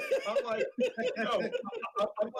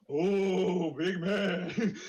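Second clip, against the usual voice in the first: mocking laugh; 3 semitones higher, 3 dB louder, much slower than usual. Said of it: "So I'm like".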